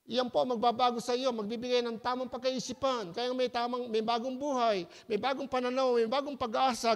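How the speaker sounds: usual speaking voice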